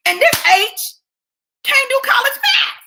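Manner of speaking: high, squeaky mock voice of herself; mock silly voice. Comic mocking herself in a high cartoonish voice.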